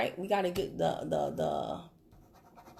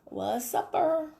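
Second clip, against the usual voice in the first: drawn-out, higher "what's supper"; mock lazy message; 5 semitones higher, much slower than usual. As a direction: parody a pointless opener. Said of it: "I would never message somebody and be like".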